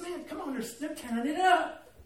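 whiny high plea to stay; pleading protest. Retold or imitated. Fisherman to his captain friend, begging to stay. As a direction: beg to keep fishing.

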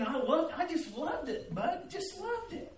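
rising high and gushing with delight; gushing joy. Real, not acted. Fisherman to his friend about the fishing, gushing. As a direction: pitch climbing on loved.

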